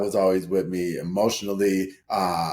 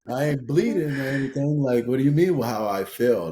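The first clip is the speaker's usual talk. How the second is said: higher-pitched, incredulous retort; baffled protest; 6 semitones higher than usual. Retold or imitated. Thirty-year-old answering a partner asking about feelings, baffled protest. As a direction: protest the question in baffled disbelief. Retold baffled reply to her question.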